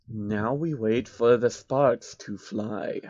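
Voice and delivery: soft, very slow sinister murmur. A narrator's reading. Soros plots alone by the balloon, soft and slow. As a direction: savour the plan quietly.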